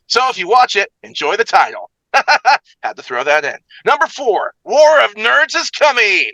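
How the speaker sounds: game-show style host with high, punched countdown calls; big showy countdown host voice; hyped recap announcer. Acted. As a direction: belt out each number, then ease into the comments. Hosts recap as Ralph McGee.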